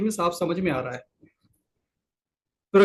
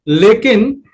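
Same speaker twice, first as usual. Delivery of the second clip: loud, punchy लेकिन; emphatic but; 2 semitones higher, 10 dB louder, much slower than usual. Real, not acted. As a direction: hit लेकिन hard to turn the point. Emphatic turn in lecture.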